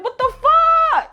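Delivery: very high, drawn-out disbelief; incredulous cry. Retold or imitated. Retelling reaction to cousins going to sleep, incredulous cry. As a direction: squeal it slowly in outraged disbelief.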